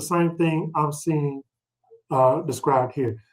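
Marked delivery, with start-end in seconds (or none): none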